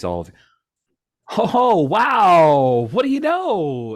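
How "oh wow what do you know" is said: high, loud gushing delight; excited shop owner. Acted. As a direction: gush like a thrilled host. GM voices Gordon's delighted greeting.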